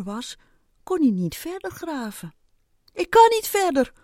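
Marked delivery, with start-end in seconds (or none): 2.9-3.9 high, loud shout; calling out. A narrator's reading. Ant calling from under the wall, shouting. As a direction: call up from down a hole. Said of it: "hoorde de eekhoorn hem roepen".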